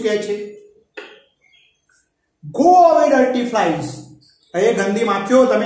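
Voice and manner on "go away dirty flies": high, loud, slow shout; angry shout at flies. Acted. Gopal yelling at flies on his jalebi, loud angry shout. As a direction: shoo the flies away angrily. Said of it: "He shouted".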